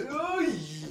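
sharp high "ay!" of a meme; mock high yelp. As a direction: squeal it like the meme. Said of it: "del que dice". (gritito agudo de meme)

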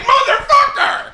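high, loud cry of frustration breaking into a laugh; frustrated yell. Real, not acted. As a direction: let the swear explode upward, half laughing. Ranting about leaving work early.

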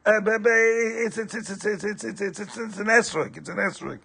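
caught stammering. Acted. Husband caught, can't answer.

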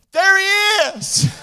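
triumphant drawn-out call; joyful shout. Pretend. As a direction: shout it out like a glad welcome. He looks at his wife and he says.

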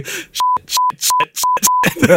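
frantic breathless whisper of curses; panicked whispered swearing. Retold or imitated. Speeding downhill, can't stop.

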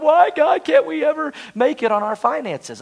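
high, pleading whine to God; whining complainer. Retold or imitated. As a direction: complain like a broke spender. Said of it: "and they went".

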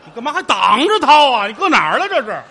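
mock surprise. Real, not acted. Mock-shocked at hidden pocket.

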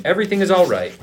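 high-pitched narrated call; crying out. A narrator's reading. Messenger cries out to the king, high-pitched call. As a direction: call out good news, pitch leaping up. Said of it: "cried out to the king".

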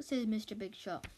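ordinary delivery, nothing marked

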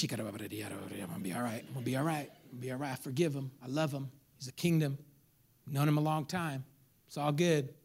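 low, soft self-reassurance; calming oneself down. Retold or imitated. Cheated buyer praying before calling the seller, calming himself down. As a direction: talk yourself down quietly, pitched low. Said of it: "I calmed myself down".

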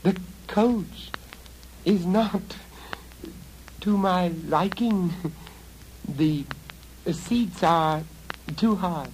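put-on high, girlish complaint; a spoiled girl complaining; girl's voice.

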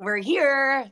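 hoarse morning voice; rough, scratchy throat. Real, not acted. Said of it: "My scratchy voice and all".

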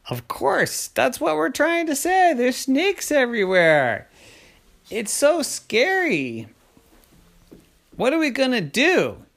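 high, whiny alarm; frantic kids. Acted. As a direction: wail it like frightened children. Scared kids answer daddy.

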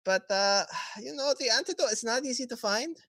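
high, lilting dealmaker voice; bargaining villain. Acted. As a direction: pitch the deal with airy, rising cheer. Villain offers antidote deal.

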